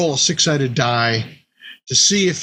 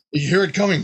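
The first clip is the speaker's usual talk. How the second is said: ordinary delivery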